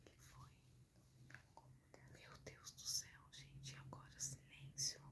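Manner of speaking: very faint frightened whisper. Real, not acted. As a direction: hush it, scared in the dark. Waiting in dark, scared. (sussurro com medo)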